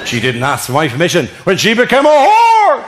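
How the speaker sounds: high-pitched incredulous rant; acted outraged dad, pitch jumping up; indignant father. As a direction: protest in mounting outrage. Imagined father ranting at suitor.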